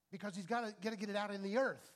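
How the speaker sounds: normal talking voice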